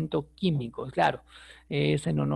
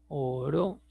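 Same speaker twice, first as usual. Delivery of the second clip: normal talking voice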